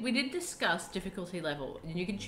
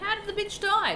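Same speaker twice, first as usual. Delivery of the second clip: mock puzzled voice; 12 semitones higher, 6 dB louder than usual. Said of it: "You're like".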